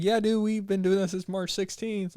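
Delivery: high laid-back bro voice; voicing Texas. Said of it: "Texas is like".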